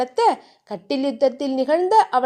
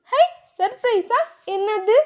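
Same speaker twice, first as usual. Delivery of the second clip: put-on much higher, delighted girlish squeal; light up at the news of a surprise; childlike delight; excited squeal; 8 semitones higher than usual. Heroine thrilled by surprise.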